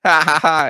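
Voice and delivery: "ha ha ha" of a man cracking up at his own joke; imitated guffaw. Checkers worker laughing at the lumberjack, imitated laughter.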